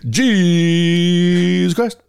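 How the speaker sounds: slow, drawn-out grand announcement; TV announcer. Retold or imitated. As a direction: announce it like a game-show host. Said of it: "that's the way they announce it".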